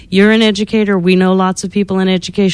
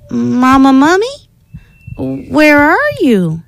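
put-on high, slow worried child calling; 6 semitones higher, slower than usual. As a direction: call out for mama in a higher little voice. Lost baby calling for mother.

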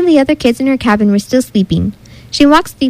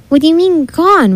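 higher-pitched, pressing question; mild frustration; 6 semitones higher than usual. A narrator's reading. Rebecca questions Mouse about Lewis, with mild frustration. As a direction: press for an answer, mildly frustrated. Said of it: "Rebecca pressed with frustration".

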